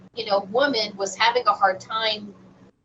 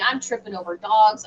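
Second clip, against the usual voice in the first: usual speaking voice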